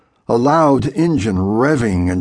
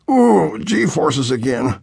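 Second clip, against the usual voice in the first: queasy groan; 5 semitones higher, slower than usual. A narrator's reading. Boy sick from g-forces.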